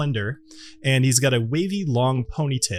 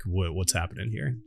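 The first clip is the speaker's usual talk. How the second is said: confused; 6 semitones lower, 9 dB quieter than usual. Acted. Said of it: "confusedly like".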